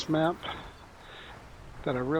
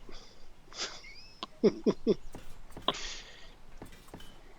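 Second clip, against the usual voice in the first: breathy laugh; 7 semitones higher, 5 dB quieter than usual. Real, not acted. Laughing at friend's loud counting.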